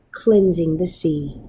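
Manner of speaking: plain reading voice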